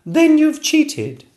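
very high, loud, slow accusation; triumphant cry. Acted. Princess exposing the dragon's cheat, high and triumphant. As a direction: catch him out gleefully. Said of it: "cried the princess".

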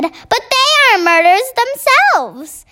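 high, emphatic objection; indignant protest. A narrator's reading. Army head argues with the Queen, protesting high.

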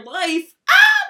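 loud, high triumphant yell; triumphant shout. Real, not acted. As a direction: build into an excited scream. Triumphant end of her pep talk.

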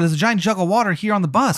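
high voice, blaming the player; lightly voiced Jay demanding why he didn't stop the fire; accusing Jay. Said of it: "He goes".

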